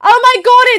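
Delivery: sudden high, loud oh my god; exasperated exclamation. Real, not acted. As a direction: blurt it out in dismay. Browser problem, sudden exclamation.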